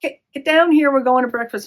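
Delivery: loud, high retold holler; shouting upstairs. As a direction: imitate Dad hollering up to a daughter. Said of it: "he shouted up the stairs".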